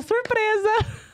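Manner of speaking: retold high drawn-out playful cry; playful surprise. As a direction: stretch it out teasingly, imitating a friend. Roberta answering on live.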